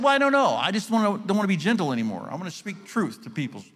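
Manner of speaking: high, loud, fed-up whine; whiny complaint. Retold or imitated. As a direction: complain like a sulky kid. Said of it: "I said".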